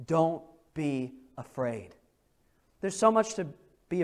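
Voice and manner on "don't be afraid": slow, soft hey, don't be afraid; tender reassurance. Retold or imitated. God to his people, tender slow reassurance. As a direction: soothe them gently. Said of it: "a message that says".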